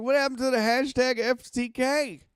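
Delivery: high, loud, outraged question; high-pitched loud retold yell; angry at mom. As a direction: demand an answer from mom. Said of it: "I was so pissed".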